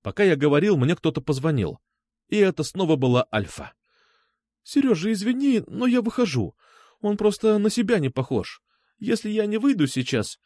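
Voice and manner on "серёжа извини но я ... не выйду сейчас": high, fragile woman's voice; anxious apology. A narrator's reading. Алёна tells Сергей she is leaving, anxious.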